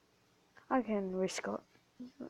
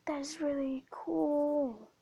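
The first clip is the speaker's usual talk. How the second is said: awed; 6 semitones higher, slower than usual. Real, not acted. Reacting to new item.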